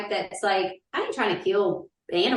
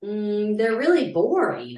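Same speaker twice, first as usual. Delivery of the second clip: ordinary delivery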